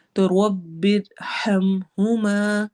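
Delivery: رب ارحمہما slow, the ha from the lowest part of the throat; deep throat ha. Shown as a demonstration. Teacher models the low-throat ha for her students, slowly. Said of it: "throat letters میں سب سے نیچے والے حصے سے".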